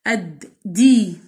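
اد، دي split and said slowly; slow syllable demo. Shown as a demonstration. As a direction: separate each syllable clearly. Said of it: "احط الدال في مقطع لوحدها".

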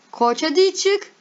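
high-pitched, loud urging; excited urging. Real, not acted. Impatient for own sign to appear. (heyecanla, tiz sesle)

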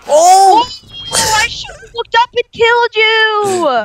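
high, loud, slow cry of frustration; frustrated shout. Real, not acted. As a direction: yell out after getting killed. Killed in the game, cries out.

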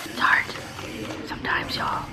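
hushed confiding whisper. Real, not acted. Mom confiding to viewers, whispered. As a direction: breathe it out like a secret. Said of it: "confiding aside".

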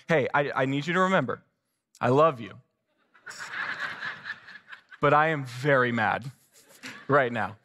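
low, mock-calm through gritted teeth; lightly voiced tense husband; mock restrained anger. Quoted to wife while frustrated.

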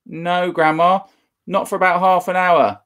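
put-on boy calling to another room; calling back. George calls back.

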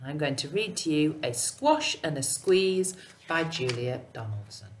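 ordinary delivery

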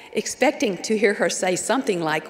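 normal talking voice

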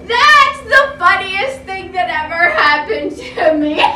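loud words through laughter; laughing. Acted. Elephant laughing at himself, words through laughs. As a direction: laugh while you say it. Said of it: "he began to giggle".

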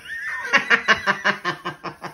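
long string of rapid chuckles tapering down; amused rolling giggle; hearty laugh. Real, not acted. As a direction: laugh at your own cheeky joke. Laughs at own joke about father.

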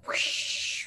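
long breathy whoosh of a rushing crowd; hushed, airy swoosh sound effect; imitated whoosh. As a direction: let out a big rushing whoosh.

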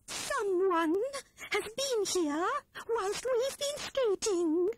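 high, slow, worried little squirrel voice; a small animal fretting over strangers in the house; anxious Squirrel. A narrator's reading. Squirrel frets to her friends over the footprints, anxious and high. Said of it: "looking anxiously up and down".

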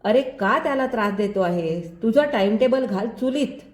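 ordinary delivery, nothing marked

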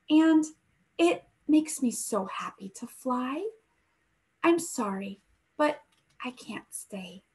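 gentle storybook read-aloud voice; story narration. A narrator's reading. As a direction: read it like a bedtime story for the class. Teacher reads picture book aloud.

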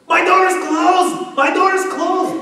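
high, loud, stretched mock child yell; screaming toddler son. Son calls to dad at night, screaming. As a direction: imitate a little boy shrieking from his room. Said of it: "and then Nathaniel goes".

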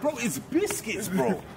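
high, slow, insistent; mock exasperated correction. Acted Brit insisting on his word, high slow exasperation.

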